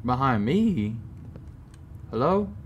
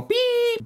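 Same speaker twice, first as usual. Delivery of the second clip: imitated beep.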